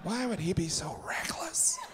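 hushed whisper, slowing at the end; mock whisper. Comedian whispers about his own reckless ketchup jokes, mock whisper. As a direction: whisper like a worried aside.

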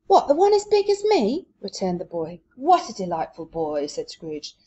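lively read-aloud with voices; story reading. Acted. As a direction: read the chapter to the class with character.

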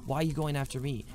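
normal talking voice

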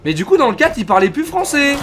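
loud, high exclamation; worked-up protest. Real, not acted. Streamer comparing the previous game's language, worked-up protest. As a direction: protest it loudly at a high pitch.

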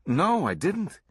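high-pitched, earnest protest; taken aback, protesting earnestly; incredulous denial. A narrator's reading. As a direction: deny it in disbelief. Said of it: "Swango looked incredulous".

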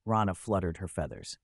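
plain reading voice

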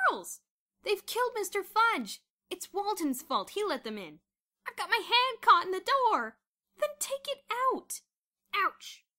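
high, loud cries of alarm; panicked hubbub shouting. A narrator's reading. Boys shouting as girls invade the dormitory, panicked shouting. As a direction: shout over a riot.

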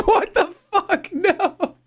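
high, incredulous laugh into a curse; real disbelieving laughter; laughing disbelief. Real, not acted. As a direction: laugh it off in shock.

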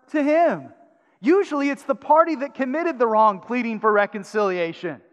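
loud, high, urgent preaching; preaching at full force. Acted. Pastor pressing the church, loud urgent preaching. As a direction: drive the point home to the congregation.

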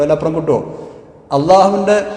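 ordinary talk

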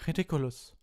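high-pitched cried-out spell; shouted. A narrator's reading. Parvati casts at the mummy, crying out. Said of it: "schrie Parvati".

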